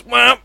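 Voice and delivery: one high honking womp; imitated car horn. Storyteller marking another ten minutes, imitated horn honk. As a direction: punch out a high horn blast.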